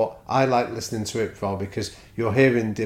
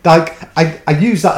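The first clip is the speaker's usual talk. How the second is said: much louder and higher, rushing to deny; excited protest; 10 semitones higher, 15 dB louder than usual. Real, not acted. Guest excitedly protesting to the host about the example. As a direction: burst out in laughing denial.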